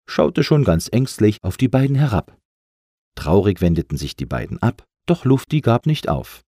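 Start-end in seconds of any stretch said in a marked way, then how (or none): none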